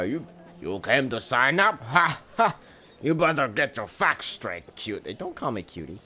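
a lightly voiced cocky soldier reading; a hint of a mocking soldier; gruff soldier. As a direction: read the recruiter's taunt with swagger. Voicing game character's lines.

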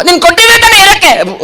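high puppet backtalk; cheeky puppet. Acted. Puppet arguing with handler over chocolates, cheeky puppet voice. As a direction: talk back in a high puppet voice.

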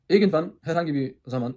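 usual speaking voice

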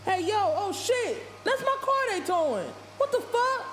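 high, stretched-out yell of shock; man shouting as his car is towed; screaming in disbelief. Acted. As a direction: yell like your car's being towed away. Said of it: "Dwayne was already screaming".

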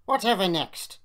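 scornful outburst; snorting. A narrator's reading. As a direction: snort it in disbelief. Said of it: "he snorted".

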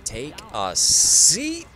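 slow, loud, high taunt; taunting shout. Acted. Player taunting a downed rival pilot, loud and slow. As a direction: draw it out and yell it.

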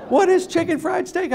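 high, loud, laughing "What is chicken fried steak?"; mock bewildered question. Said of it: "I wrote".

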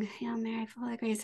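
soft, hushed prayer murmur. Retold or imitated. Son imitates the lady praying in the chapel, hushed. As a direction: mutter it like someone praying nearby.

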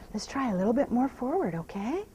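ordinary talk, nothing marked

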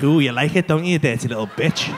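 comic low seductive voice; low mock seductive. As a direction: put on a low sultry purr of a lover. Retold partner's dirty talk.

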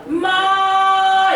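마 sung high, level and long; high level first-tone 마. Shown as a demonstration. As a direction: hold 마 high and flat like a sung note. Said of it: "일성은 반드시".

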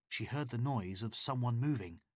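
plain reading voice

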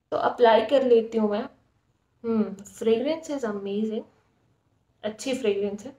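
face covered by wet mask; speaking while smoothing mask; through a sheet mask. Real, not acted.

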